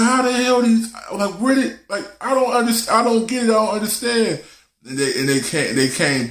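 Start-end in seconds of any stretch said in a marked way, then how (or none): 0.0-4.4 high, baffled rant of the father; dad puzzling over returning snails. Retold or imitated. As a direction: rise high in disbelief. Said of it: "My dad's like".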